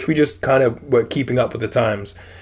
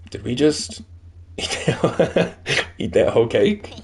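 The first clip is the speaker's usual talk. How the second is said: laughing disbelief; slower than usual. Real, not acted. Laughing over the eaten cake.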